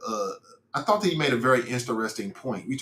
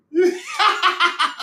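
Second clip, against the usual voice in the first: big high laugh; 5 dB louder than usual. Real, not acted. Laughing at wife and daughter.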